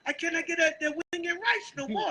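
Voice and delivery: high-pitched mock complaint; imitating Moab. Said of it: "Moab said".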